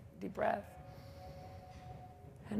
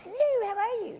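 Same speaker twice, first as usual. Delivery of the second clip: very high squeaky little voice, back in the throat; leprechaun voice; 4 dB louder than usual. Retold or imitated. Teacher imitates her ninety-five-year-old friend, very high leprechaun squeak. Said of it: "her voice is like this when she talks".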